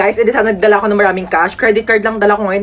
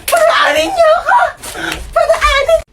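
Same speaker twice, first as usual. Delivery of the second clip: shouting way higher, demanding to pass; high shrill shout; slower than usual. Acted. Shrieking at the people blocking her way. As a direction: yell it high to force them aside.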